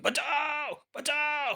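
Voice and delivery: very high, drawn-out acted wails; screams for help. A narrator's reading. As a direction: scream to be rescued from the ants. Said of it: "उबल पड़ी दोनों की चीखें". (मदद के लिए ऊँची चीखें)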